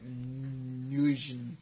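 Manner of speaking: "Nguyen" as a drawn-out "noo-jen"; naive misreading. Shown as a demonstration. Guessing at the surname, "Nguyen" misread as "noo-jen". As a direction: stumble slowly through "Nguyen" as "noo-jen". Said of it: "I would've been like".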